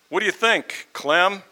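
high, loud question across the room; calling out. Acted. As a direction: call out to someone at the back. Acted call from the room.

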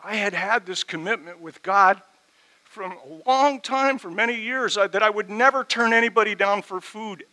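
high, loud, impassioned preaching; preaching at full force. Real, not acted. Pastor confessing his failure to the church, impassioned and loud. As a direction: pour out the regret with full force.

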